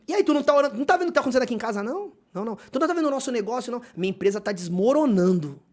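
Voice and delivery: high-pitched, rapid fretting; acted nagging complaint. Spouse complains about home and business, acted nagging. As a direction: play a worked-up partner piling up grievances.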